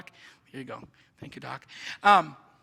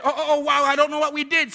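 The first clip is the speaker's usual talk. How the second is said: louder, higher stammer of astonishment; mock bewilderment, raised and emphatic; baffled doctors; 7 semitones higher, 9 dB louder, faster than usual. Doctors after the eye procedure, voiced as loudly baffled. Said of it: "The doctors were baffled by that".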